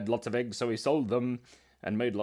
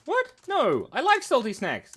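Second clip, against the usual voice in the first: louder, higher protest; mock-indignant defence of salty snacks; 12 semitones higher, 6 dB louder than usual. Real, not acted. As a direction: push back at the advice. Indignant retort to test.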